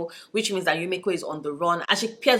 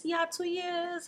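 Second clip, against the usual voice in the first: slow, high and rueful; high rueful drawl; 10 semitones higher, 9 dB quieter, much slower than usual. Real, not acted. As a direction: stretch it out ruefully. Rueful self-mockery.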